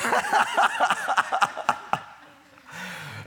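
loud, high, sustained cackling laugh; big laugh. Real, not acted. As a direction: burst into real hearty laughter. Laughs at his own slip.

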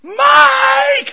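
very high, long held scream. Retold or imitated. Kid screaming into a payphone for his brother, screaming. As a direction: bellow the name at top pitch. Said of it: "I'm screaming into this phone".

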